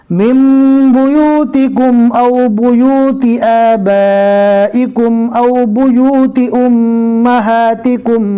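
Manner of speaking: slow melodic tajweed chant; Quran recitation. Shown as a demonstration. Teacher reciting verses to the class, melodic and measured. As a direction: recite it, drawing out each verse.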